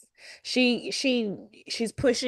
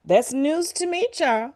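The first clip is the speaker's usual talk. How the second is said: mock surprised aside; 6 semitones higher than usual. Child's silent reaction voiced.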